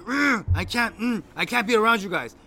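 growled grunts, then high loud words; imitated fuming. Friend storming out on the group, imitated as fuming. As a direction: act out a worked-up friend about to storm off. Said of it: "he fucking storms out from the parking lot".

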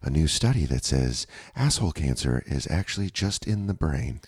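soothing NPR-style understatement; calm radio host. Acted. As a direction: report absurd news softly and serenely. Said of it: "I love how calm they are about".

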